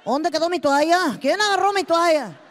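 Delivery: loud husband hollering through the house; naked husband yelling to the family for his towel. Retold or imitated. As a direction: holler for the missing towel, demanding.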